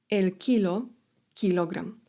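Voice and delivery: ordinary talk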